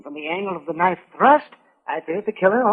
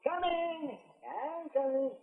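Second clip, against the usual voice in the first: far off reply; 6 semitones higher, 16 dB quieter, much slower than usual. Acted. Doc answers from another room.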